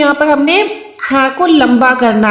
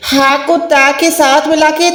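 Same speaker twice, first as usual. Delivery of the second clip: ordinary talk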